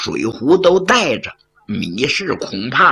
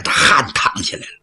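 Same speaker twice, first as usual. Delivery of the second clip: usual speaking voice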